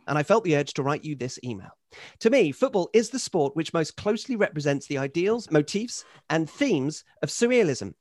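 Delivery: measured essay reading, phrase by phrase; email read aloud. Acted. Host reads Henry's surrealism thesis on football, formal read-aloud. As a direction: deliver it like a considered lecture from the page.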